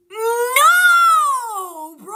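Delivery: high crying shout of refusal; loud, high-pitched wail of no. Real, not acted. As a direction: yelp it out like a terrified protest. Refusing to bait ghosts.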